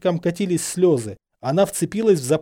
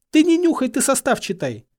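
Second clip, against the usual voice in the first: high, loud, impatient; cross, high-pitched command; exasperated order; 12 semitones higher, 5 dB louder than usual. A narrator's reading. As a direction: scold him with a raised voice. Hermione snaps an order at Harry.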